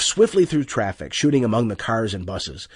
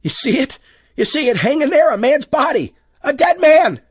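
high, loud panicked cries; rising hysteria at a hanging body; hysterical; 7 dB louder than usual. A narrator's reading. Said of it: "Lois began to get hysterical".

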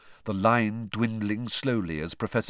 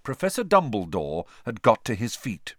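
plain reading voice

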